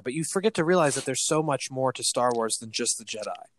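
usual speaking voice

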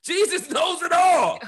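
high, drawn-out shouted declaration; emphatic preaching shout. Real, not acted. Pastor refusing to speak against Jesus, high emphatic shout. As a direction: cry it out slowly with conviction.

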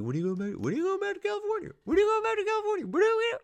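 very high, fast, pestering squeal; nagging squeaky voice. Retold or imitated. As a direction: pester like a shrill chorus. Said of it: "People are like".

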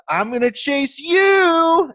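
put-on high, loud, slow little kid taunt; playful child voice. Dad imitating his little daughter starting the chase game, high and slow. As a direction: play the daughter daring dad to run. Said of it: "when Andrea goes".

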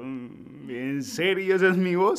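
can't believe that's really his own voice; disbelief. Retold or imitated. Speaker recalls first hearing his recorded voice, stunned disbelief. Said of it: "Y yo".

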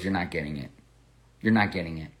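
low, soft retold verdict from a father; quiet disapproval. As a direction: imitate a dad quietly turning it down. Dad rejecting the draft sermon.

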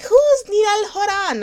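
high, slow mock-Swedish question; exaggerated Swedish-accented puzzlement; Swedish man's accent. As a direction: mimic a baffled Swede, loud and stretched out. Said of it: "the other one who was like".

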